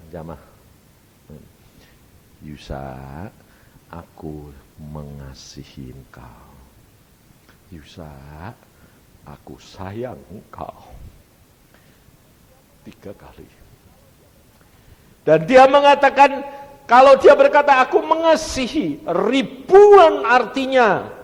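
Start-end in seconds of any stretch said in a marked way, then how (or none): 2.4-10.9 low, slow, hushed tenderness; tender divine voice. Retold or imitated. God tells Yusa of his love, tenderly. 15.2-21.1 sudden high, very loud outburst; impassioned preaching shout. Real, not acted. Preacher expounds God's words to congregation, shouting fervently.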